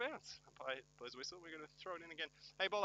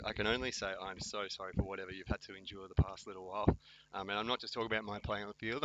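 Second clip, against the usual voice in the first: ordinary delivery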